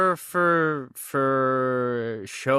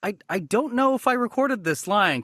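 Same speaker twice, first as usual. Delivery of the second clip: usual speaking voice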